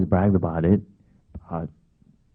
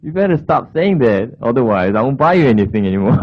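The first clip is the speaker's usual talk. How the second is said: mock upset; 4 semitones higher, 5 dB louder than usual. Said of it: "And I was really upset".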